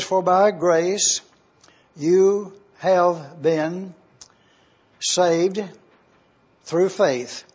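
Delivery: very slow, with long pauses between phrases; slow emphatic reading. Acted. Pastor reading the key verse to his class, slow and emphatic. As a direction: lay out each phrase of the verse deliberately.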